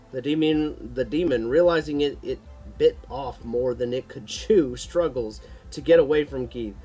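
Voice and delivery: ordinary delivery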